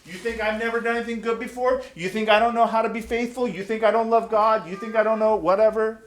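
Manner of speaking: a churchgoer taking offence; rapid indignant string of protests; defensive bluster. Retold or imitated. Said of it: "And you're like".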